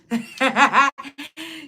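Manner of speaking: bright burst of laughter; laughing. Real, not acted. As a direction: laugh out loud at her own joke. Laughs after her own whisper.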